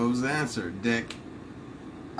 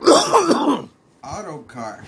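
drawn-out announcer; 7 semitones higher, 9 dB louder, much slower than usual. Pretend. Drawn-out answer reveal.

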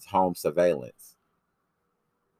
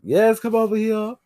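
mocking high squeal; 4 dB louder than usual. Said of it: "he got that little southern accent and he be like".

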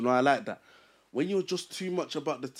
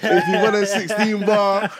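louder, higher announcement to the audience; calling out to viewers; 6 semitones higher, 11 dB louder than usual. Real, not acted. Host calling out to the viewers to comment, loud and hyped. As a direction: hype it up to the viewers.

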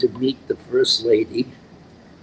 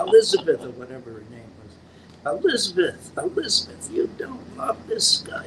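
lightly Irish, higher lover's plea; Irish accent; 3 semitones higher than usual. Retold or imitated. Actor pleading with his stage lover in an Irish accent. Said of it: "I did it with an Irish accent".

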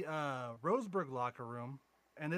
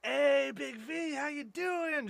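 cheery greeting; 11 semitones higher, 6 dB louder, much slower than usual. Retold or imitated. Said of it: "and I'm like".

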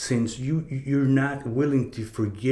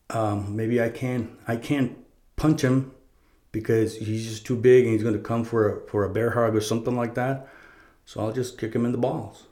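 usual speaking voice

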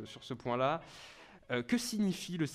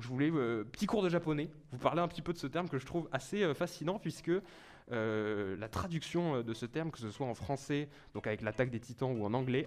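ordinary talk, nothing marked

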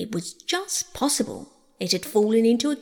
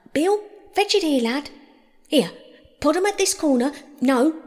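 higher-pitched workers calling instructions; lively, bustling crowd of voices; busy workmen's chatter; 6 semitones higher, 3 dB louder than usual. A narrator's reading. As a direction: swap hurried orders between several workers. Many workers' voices all talking together.

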